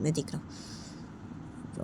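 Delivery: slow, threatening whisper; menacing whisper. Acted. Boyfriend vowing revenge on the boy, slow threatening whisper. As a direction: breathe the threat out slowly.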